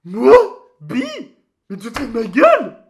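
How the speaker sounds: loud, high, fast indignant outburst; outraged. Acted. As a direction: blow up in disbelief. Outraged at being called bi.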